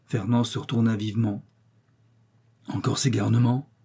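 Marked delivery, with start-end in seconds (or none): none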